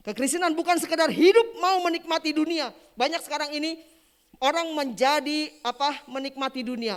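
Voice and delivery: high, loud exhortation; full-force preaching. Real, not acted. Preacher warning believers against worldliness, full-force preaching.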